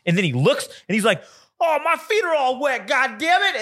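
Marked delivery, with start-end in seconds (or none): 1.6-3.6 high-pitched mock outraged wail; retold yell, pitched way up without much volume; yelling man. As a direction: imitate a man complaining in disgust. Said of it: "What are you fucking yelling about".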